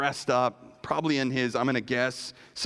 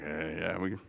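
humble brag; 9 semitones lower, 7 dB quieter than usual. Retold or imitated. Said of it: "kind of a humble brag there".

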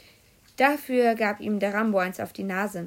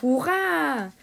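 loud, higher cheer; the whole class cheers; cheering shout; 5 semitones higher, 6 dB louder than usual. A narrator's reading. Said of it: "Da schrien wir natürlich alle". (lauter, hoher Jubelruf)

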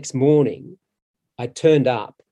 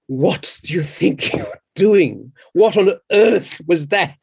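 exasperated producer; 5 semitones higher, 4 dB louder, slower than usual. Retold or imitated. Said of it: "said Cameron".

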